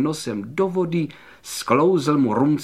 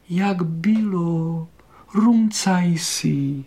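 slow, higher-voiced worried wife; ustaraná Manka; 4 semitones higher, slower than usual. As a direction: ask with drawn-out, gentle concern. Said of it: "povídá celá ustaraná". (ustaraně, protáhle a výš)

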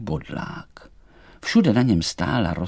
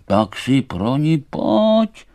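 loud, slow, higher taunt; challenging thistle; 5 semitones higher, 5 dB louder, much slower than usual. Acted. Thistle daring Emanuel to come, loud and slow. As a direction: draw it out as a dare.

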